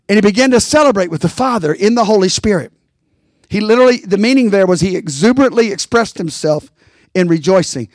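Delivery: ordinary delivery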